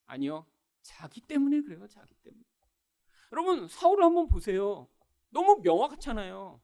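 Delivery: usual speaking voice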